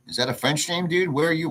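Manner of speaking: ordinary talk, nothing marked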